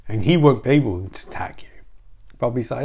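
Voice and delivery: ordinary talk, nothing marked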